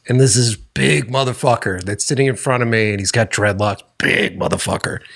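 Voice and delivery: normal talking voice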